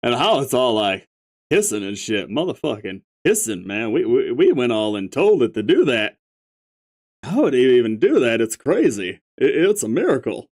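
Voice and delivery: stoner troll. Acted. Stoner figure.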